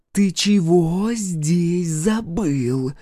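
high, slow, hostile rasp; angry wheeze. Acted. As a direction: wheeze it angrily at an intruder, high and drawn out. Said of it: "зло просипела она". (злое высокое сипение)

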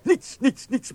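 panicked denial. Acted. Guard panics under boss's questioning.

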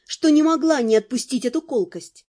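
ordinary delivery

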